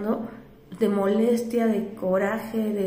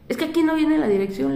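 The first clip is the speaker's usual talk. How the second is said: annoyed driver; 6 semitones higher, 3 dB louder, faster than usual. Retold or imitated. Driver arguing, retold.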